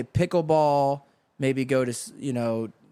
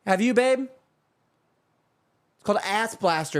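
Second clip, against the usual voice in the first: much higher, bright-eyed shopper asking; 9 semitones higher than usual. Retold or imitated. Wife asking her husband about hot sauce, much higher. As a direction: chirp the question up high to your partner. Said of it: "You'll have a talk like that at World Market".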